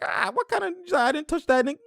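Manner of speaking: high, whiny mock protest of innocence; mock protesting player. Mocking the fouled player's denial, high and whiny. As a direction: squeak it out like a player denying the foul. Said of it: "He's like".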